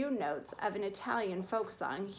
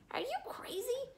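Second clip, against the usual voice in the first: much higher and softer, a hissed aside; under his breath; 6 dB quieter than usual. Acted. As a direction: scold quietly so nobody hears. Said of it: "said Chester under his breath".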